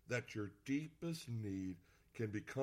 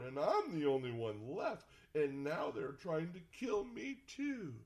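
mock whine, climbing higher and slowing; whiny Elijah; 4 semitones higher than usual. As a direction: drag out the self-pity in a put-on whine. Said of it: "he gets that whiny voice".

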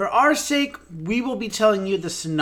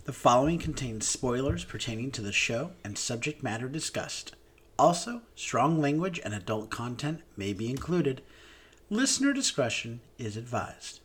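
low, measured warning read; announcer disclaimer; 3 semitones lower than usual. Acted. Host warning listeners about spoilers and strong language, announcer disclaimer. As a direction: read it out as a formal advisory. Reads the show's content warning.

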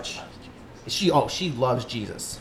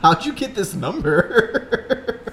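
mock-baffled missionary, higher and rising; imitated bewilderment; 5 semitones higher, much slower than usual. Host mimicking the missionary's reply for laughs, mock bewilderment. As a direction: mimic a confused man caught off guard on the phone. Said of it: "He was like".

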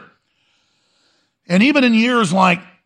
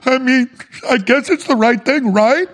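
put-on much higher, trembling, tearful old man; weepy Shatner; 9 semitones higher than usual. Host mocks William Shatner crying after the spaceflight, high and tearful. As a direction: wail it like a weepy old actor back from space. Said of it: "and goes".

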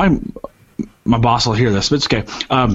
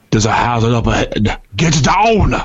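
Arnold Schwarzenegger. Retold or imitated. Imitating the app warning of a hazard ahead, Austrian accent. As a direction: warn in a fake Austrian accent, pitching up at the end. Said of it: "he's using Schwarzenegger".

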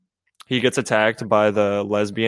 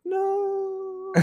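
much higher, tiny wail from far off; Knives' distant no; 8 dB quieter than usual. Retold or imitated. Quoting a girl wailing in the background, much higher. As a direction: squeak it like a heartbroken girl in the background. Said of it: "hear her going like".

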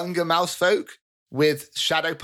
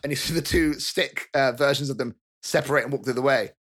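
normal talking voice

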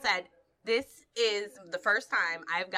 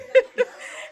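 high laugh; 8 dB louder than usual. Real, not acted. Laugh after squeal.

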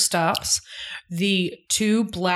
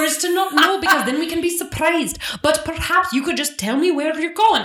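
exasperated demand; 9 semitones higher than usual. Retold or imitated. Exasperated imagined wife quote.

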